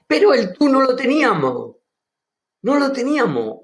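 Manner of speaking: high, loud burst of enthusiasm; the teacher lights up over the pronoun; excited. Real, not acted. Teacher excitedly reminds students about the missing pronoun. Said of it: "mi entusiasmo es grande".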